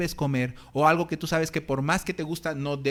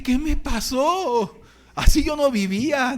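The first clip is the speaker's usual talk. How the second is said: higher, louder, slow hammed-up disbelief; a mock-horrified cry, higher and louder; mock dismay; 8 semitones higher, 6 dB louder, slower than usual. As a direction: stretch out the shock at his own weakness. Dismayed at his own weakness.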